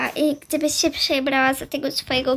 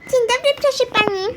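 put-on high, loud, quick little-girl voice; chirpy child greeting; 8 semitones higher, 5 dB louder, faster than usual. Mia greets her teacher, high chirpy greeting. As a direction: chirp it out high and fast like an eager pupil.

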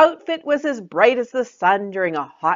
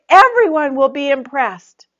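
higher, louder assurance; confident friend; 9 semitones higher, 9 dB louder than usual. Acted. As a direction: promise her success. Joy confident.

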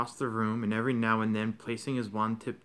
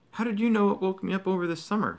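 higher, faster, startled question; astonished; 7 semitones higher, 4 dB louder, faster than usual. A narrator's reading. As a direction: blurt it out in surprise.

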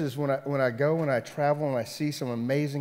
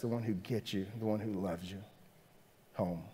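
soft, lowered landing; hushed and tender; 4 semitones lower, 9 dB quieter than usual. Real, not acted. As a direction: bring it down gently to a close. Soft close after impassioned run.

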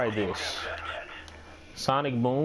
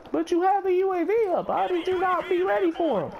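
much higher put-on voice, nagging; mock scolding teammate. Gamer mocking a teammate who scolds him, high nagging voice. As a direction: play the teammate telling him off.

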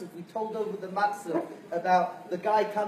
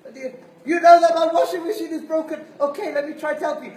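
much higher, laughing, eager-to-please; a nervous new clerk trying to help; hammed-up flustered agent; flustered customer service; 8 semitones higher, 16 dB louder than usual. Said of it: "And they're like".

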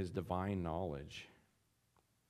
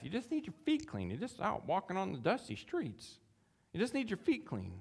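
high, light reassurance; patiently correcting a friend; gentle Jesus; 10 semitones higher than usual. Acted. Preacher voicing Jesus gently reassuring Peter.

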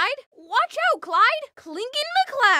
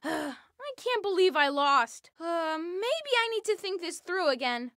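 ordinary talk, nothing marked

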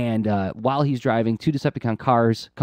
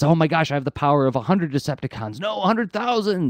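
exultant, pitch jumping high at the end; mock power-mad excitement; 6 semitones higher than usual. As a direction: play a villain gloating over new power, building higher. Mutated villain exults in new power.

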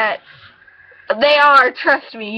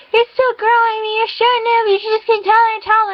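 high mock relative voice; parroting the relatives, pitched up; a hammed-up grown-up nagging; family voice; 8 semitones higher than usual. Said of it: "like, being like".

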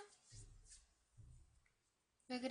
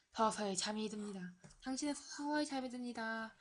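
hypnotist voice; 8 dB quieter than usual. Pretend. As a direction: lull the patient to sleep. Hypnotising the patient.

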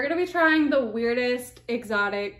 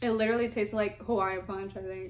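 candy near lips; sweet dissolving in mouth; talking while eating candy; 2 semitones lower, 5 dB quieter, faster than usual. Real, not acted. Seen 3: Right speaker holds pink cotton candy, lifts it to mouth while talking.

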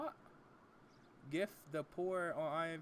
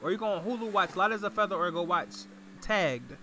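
loud; 10 dB louder than usual. Real, not acted. Shouting show list again.

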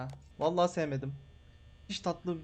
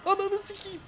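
high, strained yelp; startled shriek; 13 semitones higher, 4 dB louder than usual. Real, not acted. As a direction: jump out of your skin. Jumpscare in horror game. (ani korku çığlığı)

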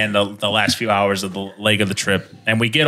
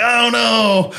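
high comic cry of dismay; mock dismay; 11 semitones higher than usual. Tourist reacting to a stranger's slur, high mock dismay. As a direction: pitch it up in hammed-up alarm.

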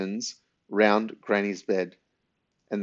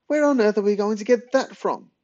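very high, louder, outraged squawk; spluttered disbelief; 12 semitones higher, 5 dB louder than usual. A narrator's reading. Granny demanding where they'd get explosives, spluttering. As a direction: protest at the top of your range. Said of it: "spluttered Granny".

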